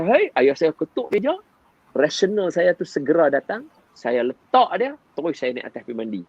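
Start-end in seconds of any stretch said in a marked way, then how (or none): 0.0-0.3 put-on high, loud "Hai," barked at the child; retold shout. Father shouting at his crying son again, loud. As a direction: yell "Hai," sharply, pitched high. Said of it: "saya kata".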